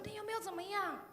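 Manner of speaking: put-on high, fretful young woman's voice; worried girl rushing up to ask; anxious young woman. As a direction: imitate her panicked asking. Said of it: "冲过来问我说".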